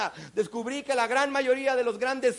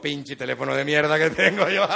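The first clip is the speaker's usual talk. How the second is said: louder, low grumble from the businessman with a laugh; jealous seatmate; 7 semitones lower, 5 dB louder than usual. Retold or imitated. Seatmate cursing his own phone, low and grumbling. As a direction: grumble like the envious BlackBerry guy.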